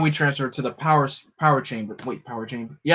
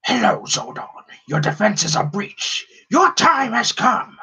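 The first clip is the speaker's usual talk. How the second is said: Lord Zed; 9 semitones higher than usual. Retold or imitated. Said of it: "my best Lord Zed impression".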